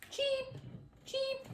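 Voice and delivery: tiny high duckling peeps; soft, squeaky baby-bird cheeps; little cheep. A narrator's reading. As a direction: peep softly, very high. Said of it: "a little cheep".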